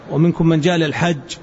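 ordinary talk, nothing marked

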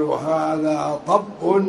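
طب as "ṭabbun", a after the heavy ṭ; fatha on ṭā'. Shown as a demonstration. Teacher shows students the a form, طب as ṭabbun. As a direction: draw out "هذا", then say طب with a short a. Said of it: "بفتح الطاء".

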